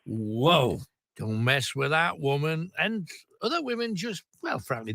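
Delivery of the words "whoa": ordinary talk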